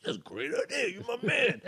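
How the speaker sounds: high, eager mock host voice; high mock voice praising the plan; excited host. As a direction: gush like a delighted creep. Creepy host voiced agreeing.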